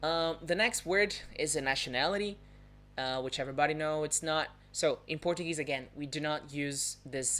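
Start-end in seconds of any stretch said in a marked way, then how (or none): none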